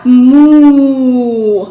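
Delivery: low it out like a cow; moo. Shown as a demonstration. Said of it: "And back to cow".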